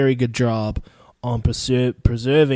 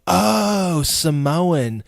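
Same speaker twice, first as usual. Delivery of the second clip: drawn-out, higher "Oh" of dawning recognition; mock realisation; 5 semitones higher, much slower than usual. Listener hearing he is Samoan, mock realisation. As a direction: imitate someone suddenly getting it. Mimics listener's sudden false understanding.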